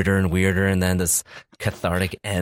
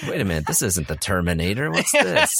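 mock surprise. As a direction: play the confused fan who expected Terminator. Mock moviegoer realizing no Schwarzenegger.

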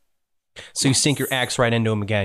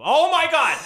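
shrieked high in mock shock; high-pitched outcry. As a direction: cry out in a much higher voice. Outburst as the creature gets thrown.

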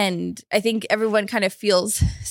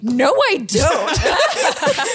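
laughing denial; 4 semitones higher, 4 dB louder, much slower than usual. Retold or imitated. Retold denial to friend, laughing.

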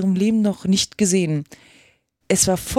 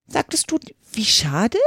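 rabbit's question; 2 semitones higher than usual. A narrator's reading. Kaninchen fragt Alice. (fragendes Kaninchen mit hoher Stimme)

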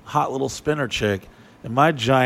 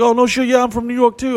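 much higher, eager put-on voice; bullshitting braggart; 9 semitones higher, 4 dB louder, faster than usual. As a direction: fake excitement to impress strangers. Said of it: "He's like".

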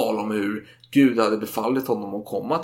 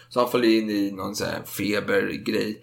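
ordinary delivery

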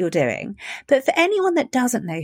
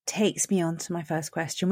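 playful stage whisper; 7 semitones lower, 8 dB quieter than usual. Real, not acted. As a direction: whisper it like a secret teaser. Teasing whisper before the big question.

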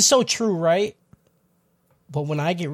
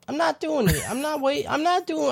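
mock refusal; 7 semitones higher, 6 dB quieter than usual. Refusing, voice jumps high.

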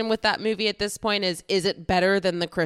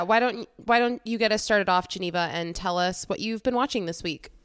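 ordinary talk, nothing marked

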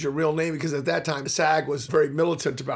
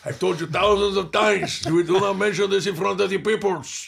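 mock Fidel scolding; 3 semitones higher, 4 dB louder than usual.

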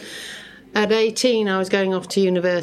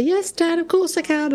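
higher, sweetly obliging "yes, Dad"; dutiful daughter; 10 semitones higher than usual. Retold or imitated. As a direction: play the eager good girl answering her father. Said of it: "And I'd say".

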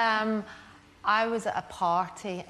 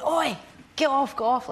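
shouting get off; 8 semitones higher, 6 dB louder than usual. Retold or imitated. Friends shouting at Paris Hilton to get off. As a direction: yell at her to get off the jackets. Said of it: "everyone's going".

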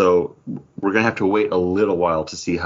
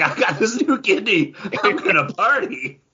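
high, laughing mock boast; mock party guy; 12 semitones higher than usual. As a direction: play him gleeful over the new kidney. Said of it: "he's like".

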